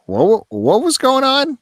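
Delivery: high-pitched, drawn-out mock bewilderment; baffled reaction. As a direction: act out total confusion, voice jumping up. Acts out baffled reaction to ending.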